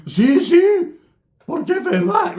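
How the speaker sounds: high, put-on eager voice; mock eager newcomer. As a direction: play a naive new arrival agreeing happily. Imitating Spanish-speaking Mino Bang.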